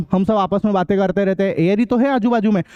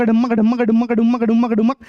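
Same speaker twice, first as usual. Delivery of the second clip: rhythmic spoken dum-dum beats; drum sound imitation; slower than usual. Shown as a demonstration. Teacher mimicking a drum for students, rhythmic. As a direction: tap out the drum beat with your voice. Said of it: "इन drum को डमक".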